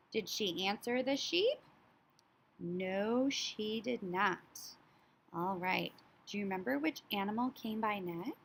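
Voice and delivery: ordinary talk, nothing marked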